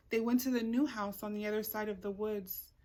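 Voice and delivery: usual speaking voice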